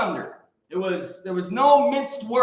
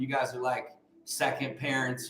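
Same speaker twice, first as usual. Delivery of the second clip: normal talking voice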